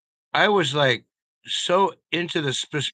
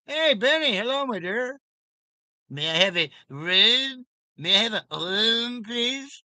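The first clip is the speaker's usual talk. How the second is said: high silly character voice; 8 semitones higher than usual. Pretend.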